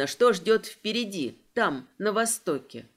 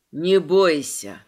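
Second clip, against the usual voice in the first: low, slow dragon reassurance; a deep dragon, firmly telling her not to fear; 5 semitones lower, 6 dB louder, slower than usual. A narrator's reading. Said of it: "Его голос звучит в голове". (низкий, медленный голос дракона)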